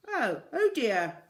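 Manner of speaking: a lightly flustered shopkeeper caught in her braces; mild loud dismay; flustered Raj. A narrator's reading. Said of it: "said Raj".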